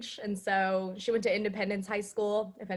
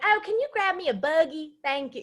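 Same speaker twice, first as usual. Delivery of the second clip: high-pitched Southern mom impression; imitated country-Southern drawl, pitched up; thick Southern drawl; 6 semitones higher, 3 dB louder than usual. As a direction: ask sweetly in mom's country accent. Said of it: "she has a really thick Southern accent".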